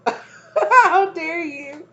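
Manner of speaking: high, very slow, mock-offended dog through a laugh; dog's indignant voice. Owner voices her dog after dropping cards on him, mock-offended and laughing. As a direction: voice the dog as a hammed-up outraged diva, laughing. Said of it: "My dog's like".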